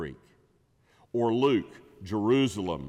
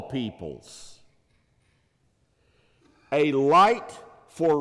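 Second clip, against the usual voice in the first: raised, measured reading of the verse; solemn scripture reading; 6 semitones higher, 6 dB louder than usual. Shown as a demonstration. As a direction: read it out like holy scripture. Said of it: "Notice the emphasis".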